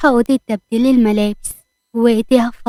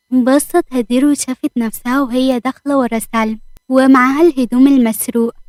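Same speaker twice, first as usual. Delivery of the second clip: plain reading voice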